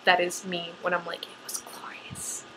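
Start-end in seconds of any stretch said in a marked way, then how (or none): 1.2-2.5 slow, hushed eerie whisper; creepy whisper. Shown as a demonstration. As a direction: breathe it out like a creepy secret. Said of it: "what I sound like when I'm writing my tags".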